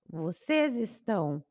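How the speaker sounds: usual speaking voice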